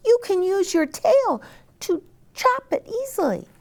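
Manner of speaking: very high, clucky hen voice. Acted. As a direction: squeak it out like a busy little hen.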